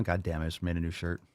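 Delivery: usual speaking voice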